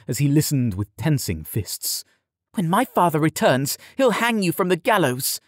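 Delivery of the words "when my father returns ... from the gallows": high, indignant warning; angry threat. A narrator's reading. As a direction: threaten him with your father's power. Yared threatens Tom in quarrel.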